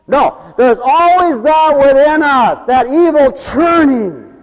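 high, loud, slow warning; forceful sermon warning; preaching. Acted. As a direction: lean into the word evil. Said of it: "preaching emphasis".

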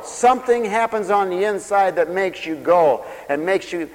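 ordinary delivery

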